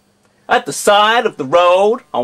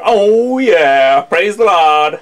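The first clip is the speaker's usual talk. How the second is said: American accent; slower than usual. Shown as a demonstration. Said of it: "in my American accent".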